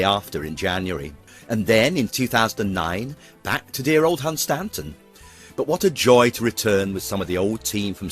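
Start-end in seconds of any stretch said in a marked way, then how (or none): none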